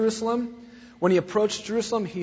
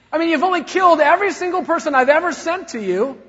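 loud, higher furious rebuke; righteous anger; 10 semitones higher, 10 dB louder, faster than usual. Acted. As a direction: blast them for killing prophets. Voicing Jesus' angry rebuke.

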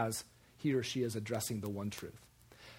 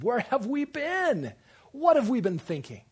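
astonished outcry; 10 semitones higher, 8 dB louder than usual. Acted. A preacher mimicking converts asking how they missed it, astonished outcry. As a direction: cry out in mock amazement, stretching it. Acted outcry of the newly convinced.

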